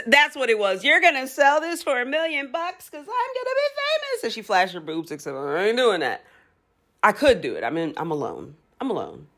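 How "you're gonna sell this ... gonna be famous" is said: imitating Manny. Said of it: "Manny from Degrassi, season five, and she's like".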